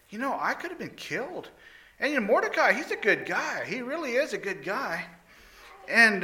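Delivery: a king realising he forgot a loyal man; king wondering aloud. Acted. King asks his servants, high and musing. King muses about thanking Mordecai.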